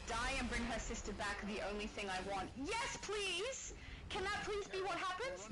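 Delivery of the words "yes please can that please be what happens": excited. Real, not acted. Eager hope for the story.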